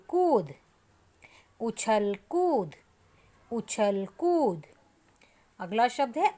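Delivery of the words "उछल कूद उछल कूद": उछल कूद said slowly, every sound distinct, weight on कूद; teacher drawing out उछल कूद for pupils to copy; slow clear model word. Shown as a demonstration. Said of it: "बिल्कुल clear voice में आपने इसको बोलना है".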